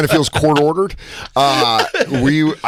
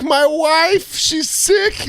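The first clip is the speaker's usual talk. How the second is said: much higher put-on terrorist voice; imitating prank caller; much slower than usual. Prank caller begging on the phone. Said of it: "He goes".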